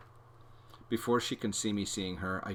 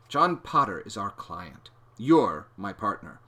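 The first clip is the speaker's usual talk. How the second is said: slow patient explaining; 9 dB louder than usual. A narrator's reading.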